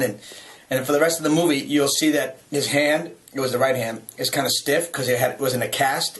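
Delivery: ordinary talk, nothing marked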